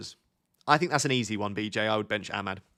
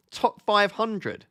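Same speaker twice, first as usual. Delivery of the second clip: amazed; 9 semitones higher, much slower than usual. Real, not acted. Impressed by viewer's world rank.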